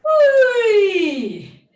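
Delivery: high loud siren whoop falling away; joyful laughing whee; playful whee. Shown as a demonstration. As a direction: swoop down like a siren. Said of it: "Let it be a playful sound".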